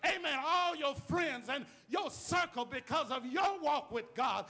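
preaching at full force. Acted. Preacher shouting to the congregation to worship for others.

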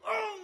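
high yelp of pain; sudden high ouch; mock pained cry. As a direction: yelp as if stubbing your toe. Hit toe retold.

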